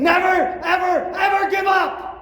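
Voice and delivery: loud, high rallying yell; quoted coach yelling at practice; coach's shout. Retold or imitated. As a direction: roar it like the coach from the sideline. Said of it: "I heard your voice from football practice saying".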